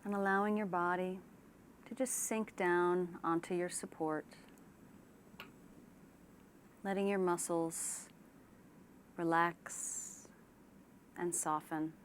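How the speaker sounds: unhurried relaxation-exercise delivery; guided meditation. Shown as a demonstration. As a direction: ease them gently into calm, leaving space after each phrase. Leads a guided imagery relaxation.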